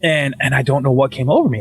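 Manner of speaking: usual speaking voice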